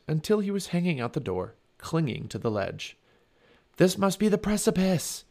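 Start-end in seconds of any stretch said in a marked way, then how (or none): 3.8-5.2 high, urgent call; raised, higher-pitched calling voice. A narrator's reading. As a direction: call up to someone out of reach. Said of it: "he called to Annie".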